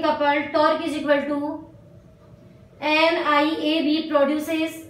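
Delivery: very slow, word by word, so students can write; slow dictation. Real, not acted. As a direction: dictate each phrase slowly and clearly. Teacher dictates notes to write. (धीरे-धीरे लिखवाना)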